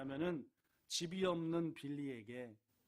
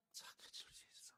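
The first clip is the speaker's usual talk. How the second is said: whispering; 18 dB quieter than usual. Acted. Grandfather's quiet counsel.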